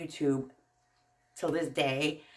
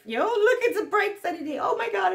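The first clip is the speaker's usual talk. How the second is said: much higher, laughing squeal of delight; over-the-top excitement; 12 semitones higher than usual. Pretend. Vlogger mocking her own excitement, squealing with delight. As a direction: gush like everything is a miracle. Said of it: "crazy [name], just gets excited".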